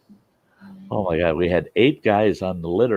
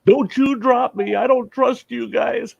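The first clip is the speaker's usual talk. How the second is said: high, pleading patient; mock scared patient; 4 dB louder than usual. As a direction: whine it like a scared patient on the stretcher. Patients' pleas, much higher.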